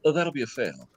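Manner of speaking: deadpan examiner. Retold or imitated. Examiner calmly announcing the failed test, deadpan and soft. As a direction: say it utterly unbothered. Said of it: "we go".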